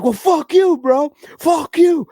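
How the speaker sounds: high-pitched angry outburst; heated, pitch shooting way up; fired-up retold yell. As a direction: re-enact yelling at another driver. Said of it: "I got all fired up".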